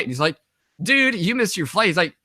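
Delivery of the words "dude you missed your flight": higher-pitched mock disbelief; incredulous retold quote; imitating amazed soldier. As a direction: react like an astonished buddy. Soldier reacts to missed flight.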